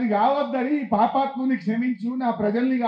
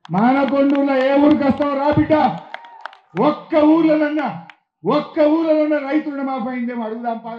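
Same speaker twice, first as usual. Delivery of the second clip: loud rally challenge; 2 semitones higher, 8 dB louder than usual. Real, not acted. Speaker dares the chief minister to visit a village, loud challenge. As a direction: call him out across the crowd.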